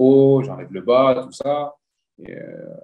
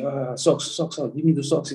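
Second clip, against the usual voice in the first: put-on higher-pitched plea in French-accented English; 4 semitones higher than usual. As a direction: ask urgently with a thick French accent in English. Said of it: "avec mon anglais, mon accent et tout". (anglais avec accent français, voix plus aiguë)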